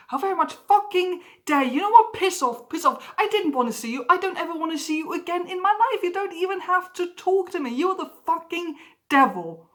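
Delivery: high, loud tirade of outrage; furious outburst. Acted. Snubbed friend raging over cancelled plans, furious. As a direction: explode at them, pitch and volume jumping up.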